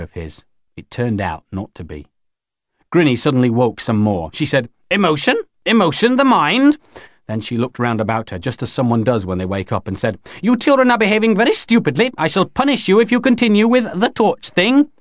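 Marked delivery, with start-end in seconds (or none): 4.9-6.8 very high, rising alien voice; questioning Grinny. A narrator's reading. Grinny suddenly waking some more, high and questioning. 10.4-14.9 put-on high-pitched, imperious old alien; scolding Grinny. A narrator's reading. Grinny threatens to punish the children, high scolding.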